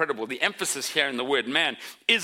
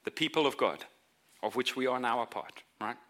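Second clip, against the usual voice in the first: normal talking voice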